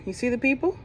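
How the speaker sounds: ordinary delivery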